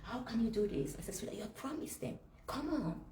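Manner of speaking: retold upset appeal, voice lifted but soft; upset pleading. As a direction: plead with him in disbelief, softly. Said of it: "I was so upset".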